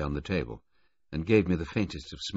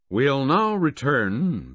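louder, higher, slow announcement; more loudly; 9 semitones higher, 8 dB louder, much slower than usual. A narrator's reading. Bill Baudelaire calling the inquiry back to order, louder. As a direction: call the room back to order. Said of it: "said more loudly".

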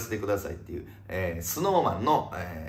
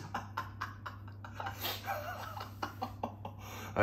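soft, airy, trailing laughter; wheezing laugh; 16 dB quieter than usual. Real, not acted. Host amused by an unfollow comment, wheezing laugh. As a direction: wheeze out a long quiet laugh.

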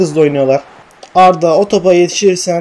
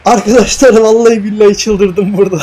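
laughing; 3 semitones higher, 4 dB louder than usual. Real, not acted. Laughs at his own meltdown.

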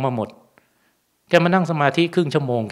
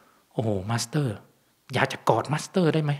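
awed surgeon; 6 dB quieter than usual. Retold or imitated. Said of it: "บอก".

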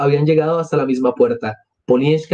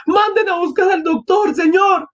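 very high cry for help; acted desperate entreaty; urgent plea. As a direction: plead high for someone to fetch help. Sonia begging for a doctor.

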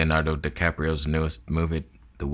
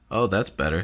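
normal talking voice

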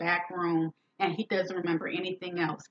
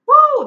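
whoop; 18 dB louder than usual. Real, not acted. Reacting to the outrageous story.

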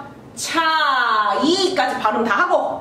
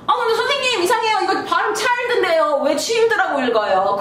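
mock puzzled student; 6 semitones higher, faster than usual. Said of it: "그렇죠".

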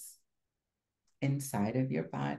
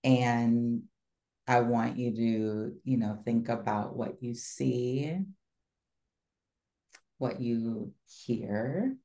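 guided visualization. Acted. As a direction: lead them gently into the scene.

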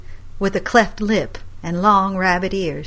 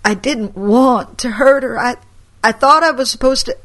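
higher, tearful rambling; distraught confession; 5 semitones higher, 5 dB louder than usual. Acted. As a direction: near tears explaining. Grandson distraught.

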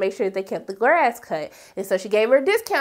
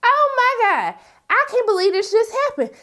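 shocked; 10 semitones higher than usual. Retold or imitated. A stunned viewer reacting to the scene, high shocked cry. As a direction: gasp it out in disbelief. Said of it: "I'm like".

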